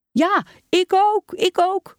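very high, eager clamour; children calling out. A narrator's reading. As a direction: chime in high like excited pupils. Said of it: "riepen er meer".

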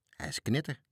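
ordinary delivery, nothing marked

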